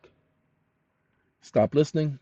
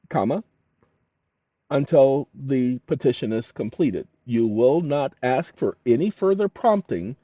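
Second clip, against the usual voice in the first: dictating. Real, not acted. Dictating strict instructions to AI.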